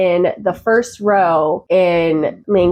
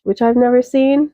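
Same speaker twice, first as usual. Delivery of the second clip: ordinary talk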